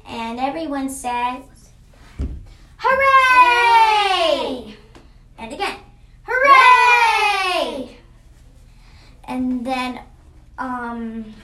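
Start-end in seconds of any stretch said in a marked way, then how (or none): 2.8-4.8 high, loud, drawn-out hooray; long shouted cheer, falling at the end; big cheer. Acted. Everyone cheers in story. 6.2-8.0 high, loud, drawn-out hooray; long shouted cheer, falling at the end; big cheer. Acted. Everyone cheers again.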